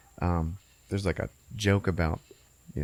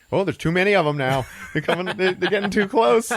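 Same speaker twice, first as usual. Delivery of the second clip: high, loud panic; hammed-up frightened stoner; mock scared; 10 semitones higher, 4 dB louder than usual. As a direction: build into comic alarm, higher and higher. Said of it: "he gets all scared".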